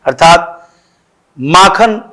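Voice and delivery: normal talking voice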